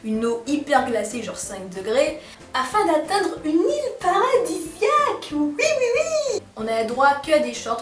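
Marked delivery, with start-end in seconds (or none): 2.5-6.4 high, drawn-out gushing; sarcastically thrilled, climbing higher and higher; mock delight. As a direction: gush in a rising, overdone high pitch. Gushes mockingly over an icy water ordeal.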